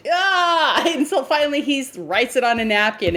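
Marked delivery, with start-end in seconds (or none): 0.1-0.8 high, exclaimed "ah" of exasperation; mock exasperated groan. Man pestered to marry, mock exasperated cry. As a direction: cry out as if pestered past bearing.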